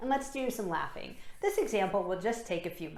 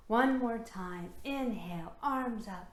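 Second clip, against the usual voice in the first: ordinary delivery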